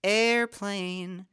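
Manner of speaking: airplane said in two syllables, air-plane. Shown as a demonstration. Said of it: "not two syllables".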